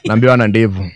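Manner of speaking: can't keep a straight face; laughing out the gossip. Real, not acted. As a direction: say it through laughter. Laughing while telling gossip.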